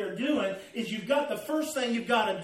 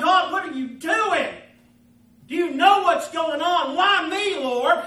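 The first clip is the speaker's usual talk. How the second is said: loud, high anguished plea; crying out; 6 semitones higher, 7 dB louder than usual. Acted. Believer on the potter's wheel questioning God, anguished cry. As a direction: cry up to heaven in protest. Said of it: "you're crying out".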